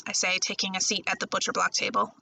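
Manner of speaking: ordinary delivery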